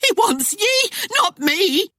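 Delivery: very high-pitched, frantic squeal; scream. A narrator's reading. Wife turns on her husband, screaming. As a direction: squeal it out to save yourself. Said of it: "his wife screamed".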